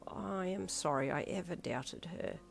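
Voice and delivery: put-on slow, soft old man near tears; an old man holding back tears; slow tearful whisper of regret. A narrator's reading. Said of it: "a tear slipped down his cheek".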